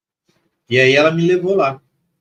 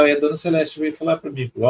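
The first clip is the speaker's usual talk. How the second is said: ordinary talk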